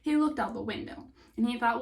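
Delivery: ordinary delivery, nothing marked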